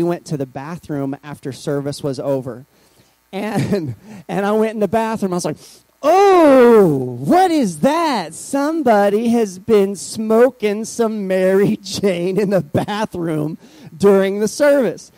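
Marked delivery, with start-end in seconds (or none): 6.0-15.1 high, loud, drawn-out exclamation; mock shocked reaction. Speaker recounting the bathroom smell after service, mock shocked. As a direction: stretch it out in exaggerated, scandalized disbelief.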